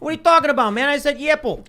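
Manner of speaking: put-on loud, high, indignant Chicago accent. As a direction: protest like a worked-up Chicago local. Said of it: "please do your Chicago accents".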